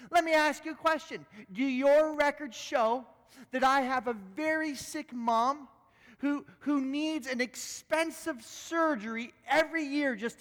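high, loud, indignant outburst; angry lawyer. Retold or imitated. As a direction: snap back at her, outraged. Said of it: "he snapped her and said".

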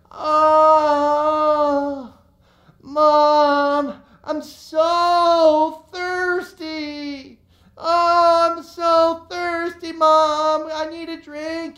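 put-on high, drawn-out childish whine; whiny kids. Kids on trikes whining to mom, high and slow. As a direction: whine like a thirsty little kid. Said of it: "they start to whine".

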